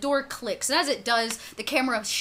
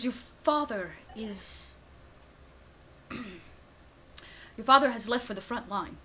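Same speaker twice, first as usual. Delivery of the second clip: grave butler; slower than usual. Acted. Butler breaks grave news.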